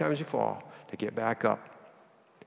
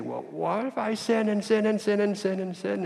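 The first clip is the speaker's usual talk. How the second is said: worried sinner; 9 semitones higher than usual. Retold or imitated. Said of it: "some people can say".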